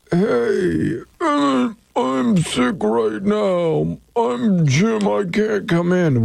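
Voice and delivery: high, drawn-out ailing whine; fake sick voice. Shown as a demonstration. Host demonstrating a call-in to work, fake sick voice. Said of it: "with your best sick voice".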